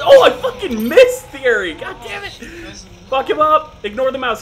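loud hyped yelling; excited shouting. Real, not acted. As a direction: shout at the screen. Heated game moment.

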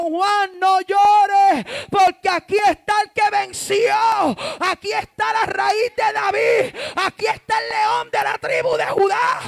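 high, shouted preaching at full force; triumphant proclamation. Acted. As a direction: proclaim victory in long, slow shouts. Preacher proclaims heaven's answer. (proclamación triunfal a gritos)

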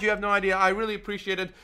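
ordinary talk, nothing marked

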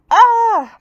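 high, loud startled scream. A narrator's reading. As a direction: shriek in sudden fright at the dog. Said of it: "Mike screamed".

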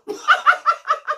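a squealing burst of laughter; high shrieking laugh. Real, not acted. Laughing at own joke.

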